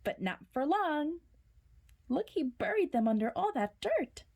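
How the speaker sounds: plain reading voice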